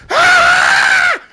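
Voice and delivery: huge high screaming crowd roar. Retold or imitated. Arena crowd erupting as Stone Cold's music hits, full scream.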